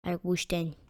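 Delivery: low-key, very soft decision to oneself; quiet muttering. Real, not acted. Player settles on using logs, quiet muttering. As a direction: say it softly under the breath. First quiet take of same words.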